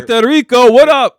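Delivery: loud, high shout-out. Real, not acted. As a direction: call out to the listeners. Shouting out to listeners.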